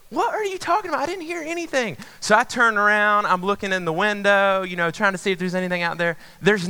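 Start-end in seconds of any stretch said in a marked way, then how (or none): 0.1-2.0 high-pitched mock incredulity; retold exasperation. Boyfriend disputing the noise with girlfriend, acted exasperation. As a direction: squeak out an exasperated denial.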